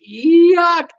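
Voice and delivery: high, loud yuck; disgusted. Real, not acted. Host reacting to a viewer's smelly cruise story, loud disgust. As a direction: recoil from the stench.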